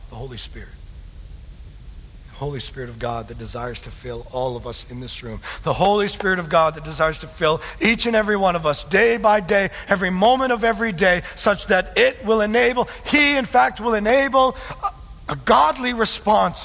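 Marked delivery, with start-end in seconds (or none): 5.6-16.6 high, forceful sermon voice; impassioned preaching. Real, not acted. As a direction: preach it in astonishment at full force.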